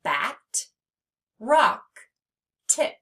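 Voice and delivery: "Bat", "rock", "tip" each isolated by a long pause; spaced-out word list, "Bat", "rock", "tip"; example words. Shown as a demonstration. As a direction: present each short word as one crisp beat. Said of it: "short syllable examples".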